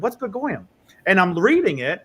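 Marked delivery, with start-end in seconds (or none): 0.0-0.7 puzzled question. Retold or imitated. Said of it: "I said".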